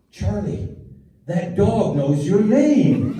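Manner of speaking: high, drawn-out wonder; slow, high child voice full of amazement; mimicked amazed kid. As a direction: gasp it out like an awestruck preschooler. Awestruck boy caught up in show.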